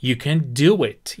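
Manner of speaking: slow, falling on do; slow model phrase. Shown as a demonstration. Teacher models encouragement for students, slow. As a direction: give it slowly for the class. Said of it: "بهش میگی".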